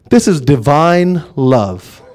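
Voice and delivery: low, soft and very slow; solemn heavenly voice. Retold or imitated. The voice answers the prophet beyond time, solemn and slow. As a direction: answer slowly and softly, with hushed weight.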